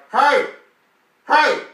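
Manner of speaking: very high, loud shout; megaphone-loud. Acted. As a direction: blast it right into someone's ear. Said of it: "it'll be a megaphone right in your ear".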